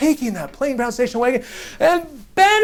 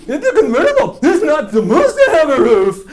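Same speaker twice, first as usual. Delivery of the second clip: high, exaggerated kid reiterating; insistent mock kid; 5 semitones higher, 5 dB louder than usual. Kid explaining his car to the trader again, insistent mock kid voice. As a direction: repeat it as an indignant little kid. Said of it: "he reiterated to me".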